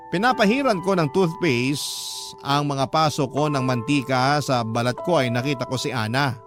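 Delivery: normal talking voice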